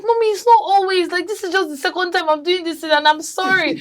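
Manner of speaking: high-pitched pleading to mom; imitating her younger self pleading, high; pleading child. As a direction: beg mom like a scolded kid. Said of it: "I'll be pleading".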